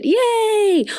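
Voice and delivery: high held cheer; high-pitched mock celebration; squealed yay. Real, not acted. Mock cheer at nap pun.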